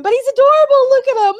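high, loud cooing over a cute dog; gushing. Real, not acted. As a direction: coo like you just saw a puppy.

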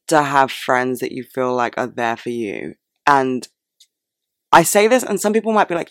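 ordinary talk, nothing marked

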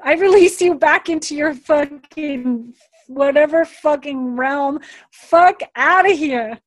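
high, drawn-out mock-ceremonial send-off; emphatic dismissal. Releasing someone back to their realm, emphatically dismissive. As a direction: build to a loud, slow kiss-off.

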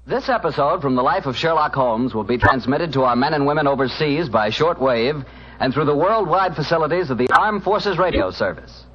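grand show introduction, rising; old radio announcer. Acted. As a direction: announce the stars with fanfare.

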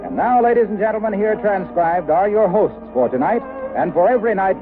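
normal talking voice

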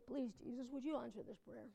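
very soft, low, meek request; hushed, courteous little plea; mock polite prayer. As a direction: pray it quietly and formally, with no urgency. Mock polite prayer, contrasted with yelling.

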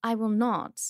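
put-on slow, halting royal English accent; Prince William. Prince turning down a woman's advance, slow English accent. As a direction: refuse politely in measured royal English. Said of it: "do you like Prince William".

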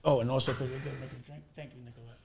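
off-mic aside. Real, not acted. Thanking the server for the drink, spoken far from the mic. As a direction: talk away from the microphone to someone else.

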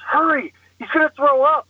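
high, pressing mock urgency; urgent wife. Wife warns husband about the man in front, urgent. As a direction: imitate a frantic, high-pitched wife.

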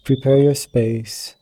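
ordinary delivery

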